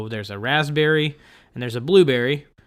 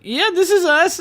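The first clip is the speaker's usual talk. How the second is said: much higher, louder chipper reply; cheerful phone reply; 6 dB louder than usual. Retold or imitated. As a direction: chirp it like a call centre agent. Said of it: "And I was like".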